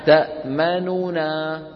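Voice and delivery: تأمننا said slowly with three equal moving nuns; تأمننا with every nun fully voiced, slow; all three nuns voiced. Shown as a demonstration. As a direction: sound out تأمننا syllable by syllable to feel the weight. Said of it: "بحركات متساويات".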